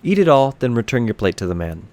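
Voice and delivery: ordinary delivery, nothing marked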